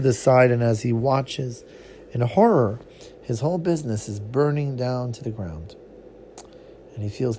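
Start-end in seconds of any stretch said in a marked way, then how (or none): none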